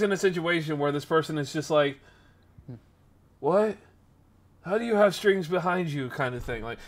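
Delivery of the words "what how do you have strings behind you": mildly imitated bafflement, higher; baffled. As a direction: ask it as if lightly mimicking someone who can't believe it. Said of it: "this guy just, like, baffled".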